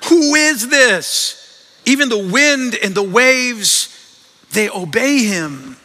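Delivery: high, loud, very slow astonishment; acted-out stunned disbelief, drawn out; terrified awe. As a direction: start high and loud, settle slowly into awe. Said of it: "they were terrified and asked each other".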